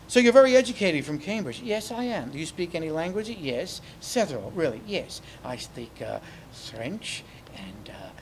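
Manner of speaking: lightly posh English puppet chat; a prim Cambridge type showing off; British puppet. Pretend. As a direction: puppet claims languages to handler, lightly British.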